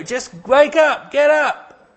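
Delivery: high, loud urgent call to wake; mock wake-up call. Disciples in the storm rousing Jesus, high urgent call. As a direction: shake him awake with your voice.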